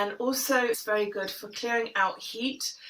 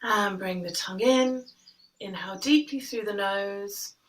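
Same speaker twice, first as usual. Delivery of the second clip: guided meditation. Acted. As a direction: guide the breath gently, unhurried. Leads breathing meditation.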